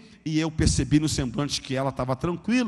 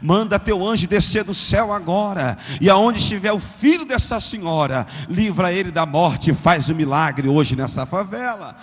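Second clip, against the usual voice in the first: loud, fervent Pentecostal prayer; shouted prayer; 3 semitones higher, 5 dB louder than usual. Retold or imitated. Pentecostal sister interrupts him praying at the top of her voice. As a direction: cry out to God over everyone. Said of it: "cortou minha oração na base do grito".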